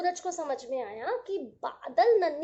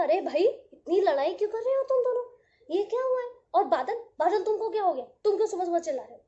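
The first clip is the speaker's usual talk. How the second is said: louder, higher, fast peacemaking sun; sun calming a quarrel; 3 semitones higher, 5 dB louder, much faster than usual. Acted. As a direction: step in between two squabbling friends.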